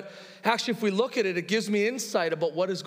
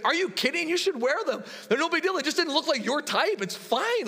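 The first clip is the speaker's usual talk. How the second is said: put-on teen girl voice; 11 semitones higher than usual. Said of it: "She's like".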